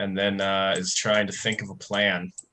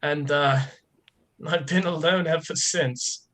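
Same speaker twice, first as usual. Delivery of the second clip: much higher, small and pleading; pitiful lost wanderer; 9 semitones higher than usual. Acted. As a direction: play a tiny lost soul begging for sympathy. Player voices Raptor.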